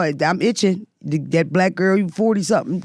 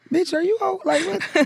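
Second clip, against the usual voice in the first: put-on much higher, incredulous teen voice; mocking teen daughter; 12 semitones higher than usual. Mom imitating her teen daughter scoffing at her, high incredulous voice. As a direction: toss it off like a baffled bratty teenager. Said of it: "my daughter was like".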